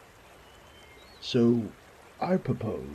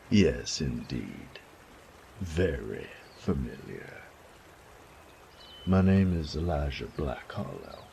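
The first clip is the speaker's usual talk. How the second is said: wry and unimpressed; rough young man; 4 semitones lower than usual. Acted. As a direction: Elijah introducing himself to the nobles. Elijah's voice, lower.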